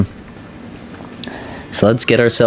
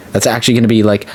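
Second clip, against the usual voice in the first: ordinary talk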